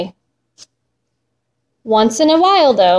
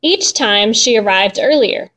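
ordinary delivery

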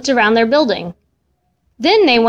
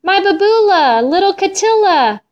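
joyful exclamation; 8 semitones higher than usual. A narrator's reading. Missus Katz gets her cat back, exclaiming joyfully. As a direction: exclaim over a lost pet found. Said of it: "Missus Katz exclaimed".

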